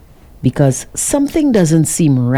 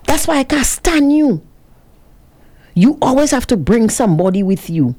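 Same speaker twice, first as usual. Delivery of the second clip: higher-pitched scolding, imitating her rant; mock telling-off, raised pitch but not shouted; retold yelling; 8 semitones higher than usual. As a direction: scold the puppy as she did, pitch raised. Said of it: "started yelling at the puppy".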